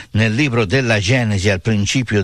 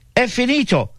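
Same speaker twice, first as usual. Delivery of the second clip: high-pitched cry, no added volume; retold dying cry; 12 semitones higher than usual. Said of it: "con l'ultimo rantolo della sua voce gridò". (grido riferito, acuto ma non urlato)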